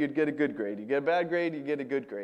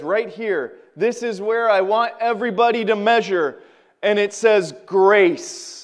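louder and higher, proclaiming each line; full-force preaching; 5 semitones higher, 7 dB louder than usual. Acted. Preacher reading Romans to the congregation, proclaiming loudly. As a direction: preach the commandments to the room.

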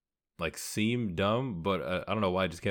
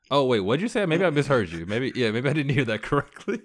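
mock sheepish query; 5 semitones higher, 4 dB louder than usual. Acts out asking for a repeat.

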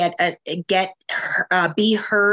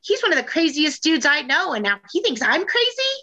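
retold outrage; 7 semitones higher than usual. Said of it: "I said".

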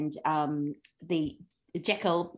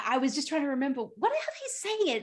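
ordinary talk, nothing marked

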